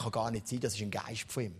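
doubting mutter. Acted. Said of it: "die andere sagt".

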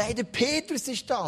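excited call. Acted. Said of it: "dann geht sie da".

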